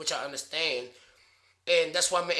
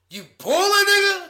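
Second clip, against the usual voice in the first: hype call; 13 semitones higher, 9 dB louder than usual. Retold or imitated. Mock [name] hype.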